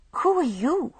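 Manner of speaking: a frightened patient demanding who he is. A narrator's reading. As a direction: demand it, startled and scared. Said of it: "demanded Patricia".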